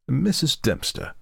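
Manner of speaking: formal, slow and grave address; grave young gentleman. Acted. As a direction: speak her name with mock solemnity. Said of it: "said Malcolmson gravely".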